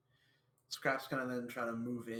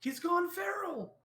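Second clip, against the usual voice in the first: very high, loud, drawn-out squeal; mock alarm cry; 7 dB louder, slower than usual. Player joking about the biting lizard man, high mock cry. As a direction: squeal it slowly in play alarm.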